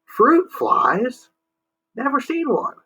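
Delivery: put-on high, slow, confused old-man voice; a sleepy old man mishearing; deaf old man. Moon mishears again.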